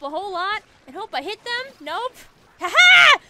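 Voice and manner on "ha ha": much higher, much louder whoop; triumphant shout. Real, not acted. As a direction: cheer at the top of the lungs. Gets a kill, shouts in triumph.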